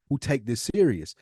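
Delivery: ordinary talk, nothing marked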